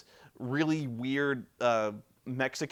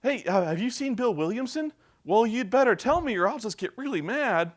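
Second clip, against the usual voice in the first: goofy friendly hero; 9 semitones higher, 4 dB louder than usual. Retold or imitated. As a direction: play the hero as a harmless nice guy. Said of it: "John Marston's like".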